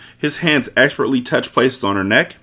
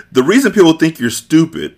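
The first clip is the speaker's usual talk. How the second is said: loud scornful sneer; mock him loudly; contemptuous; 7 semitones higher, 6 dB louder than usual. Sicilian mocks the Turk.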